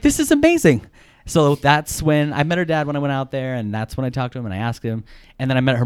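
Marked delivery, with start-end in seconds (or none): 0.0-0.9 high-pitched giddy delight; thrilled. Retold or imitated. Suitor thrilled at getting dad's approval, giddy. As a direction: squeal it up high in re-enacted joy.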